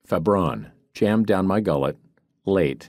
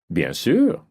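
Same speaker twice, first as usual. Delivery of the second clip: put-on higher, French-accented reply; 5 semitones higher than usual. A narrator's reading. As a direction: answer with easy French assurance. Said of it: "he said in a heavy French accent".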